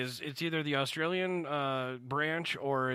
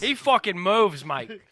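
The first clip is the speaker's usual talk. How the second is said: put-on Boston accent; 5 semitones higher, 7 dB louder, slower than usual. Switching accents for Freddy.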